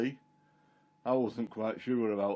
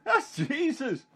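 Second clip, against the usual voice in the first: high and slow again, full of wonder; excited recognition; 13 semitones higher, slower than usual. Retold or imitated. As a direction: say it with astonishment. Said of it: "he said".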